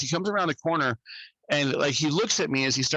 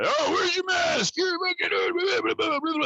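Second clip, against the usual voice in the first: mocking mask nag. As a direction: parody a preachy shopper. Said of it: "you know".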